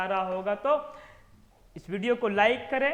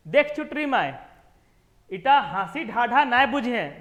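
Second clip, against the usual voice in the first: husband teasing; 7 dB louder than usual. Acted. Husband teasing wife in scene.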